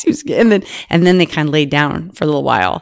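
usual speaking voice

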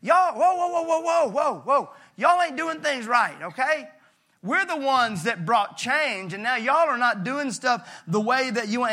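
high, loud, protesting whoa; hammed-up huffy disciples scolding Jesus; indignant disciples. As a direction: complain like offended church insiders. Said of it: "they go".

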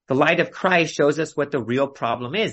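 ordinary talk

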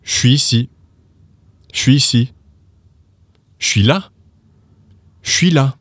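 "Je suis ici" as "shwee-see", "Je suis là" as "shwee la"; slang contraction. Shown as a demonstration. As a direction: say each "sh" contraction for the learner to repeat. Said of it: "repeat with me".

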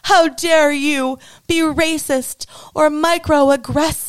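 mock tantrum. Host imitating people throwing tantrums, mocking whine. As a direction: parody an offended scold, hammed-up. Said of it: "throwing their tantrums and saying".

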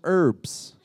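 "herbs" with a silent h, urbs; American pronunciation. Retold or imitated. Brit quoting a guest in the kitchen, "herbs" said as urbs. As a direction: drop the h of "herbs". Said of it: "There's an H there".

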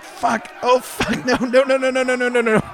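high, stuck-sword panic; panicking bandit. Acted. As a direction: rattle out the no's in rising alarm. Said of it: "And he's like".

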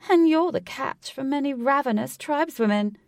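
high, loud, teasing delivery with a cackle; a hint of a wicked cackle; cackling tease. A narrator's reading. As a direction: relish the joke. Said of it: "with a cackle at the end".

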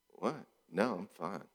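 soft, curt passive-aggressive man; a quiet brush-off denial. Retold or imitated. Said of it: "Or a man".

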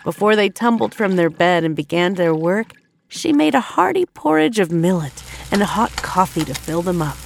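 plain reading voice

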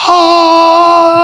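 long, high held note; heavenly "ahhh". Retold or imitated. Storyteller voices what he heard on the steps, long high sung note. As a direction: hold one steady high tone like a choir. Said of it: "I heard something go".

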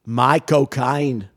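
very slow, botched Cockney; badly faked Cockney British accent. Shown as a demonstration. Trying a phrase on a friend's request, clumsy Cockney accent. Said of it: "like a Cockney British accent".

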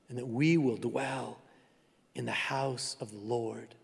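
soft and low, fading toward a whisper; hushed closing. Acted. Pastor closing the sermon, softening to a whisper. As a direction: let the blessing die away to a whisper.

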